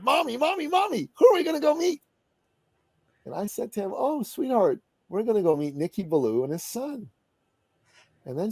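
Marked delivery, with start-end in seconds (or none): 0.0-2.0 put-on very high, eager child voice; excited little boy. Little son asking his mommy, excited. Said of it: "in that excited way little boys do". 3.9-7.1 higher, gentle sing-song reply; tender mother. Acted. Mother telling her little son who they'll meet, tender.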